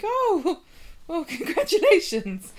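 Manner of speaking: high, bright mock surprise with a laugh; mock surprised Emma. Emma surprised by Faye's pregnancy, high and amused. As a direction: act caught off guard, lightly.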